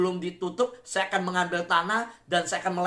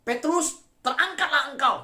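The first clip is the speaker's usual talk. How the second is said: high, raised divine command; ringing voice of the Lord; God commanding; 8 semitones higher, 4 dB louder than usual. Acted. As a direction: proclaim it as an order from God. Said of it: "Bisa nggak Tuhan berkata".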